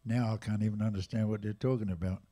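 ordinary talk, nothing marked

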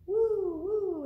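loud, very high drawn-out hoot; owl hoot. A narrator's reading. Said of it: "asked the owl".

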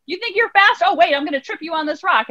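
playground-style taunting; taunting bully. Acted. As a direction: jeer and goad in a put-on high voice. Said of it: "The bully comes back with a".

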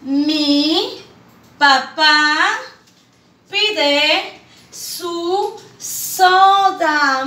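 "Mi papá pide su soda" read slowly as one phrase; whole sentence joined, slow and clear; smooth reading. Shown as a demonstration. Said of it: "qué dice".

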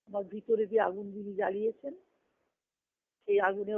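usual speaking voice

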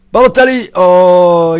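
high, loud yell of the name; real shout; excited shout. Real, not acted. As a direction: scream the player's name. Yells as striker breaks free.

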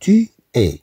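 "Tu es" said high, es as A; pronounced "Tu es". Shown as a demonstration. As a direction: lift "Tu es" clearly for the class. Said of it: "E S se prononce A".

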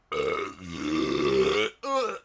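low, loud coughing; long rough cough; deep hacking cough. Real, not acted. Coughing fit continues.

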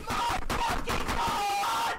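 high, slow, furious scream; rage scream. Real, not acted. Gamer screaming at the game that won't build, high rage scream. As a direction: scream it at the screen in pure fury.

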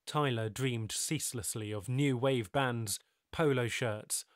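ordinary delivery

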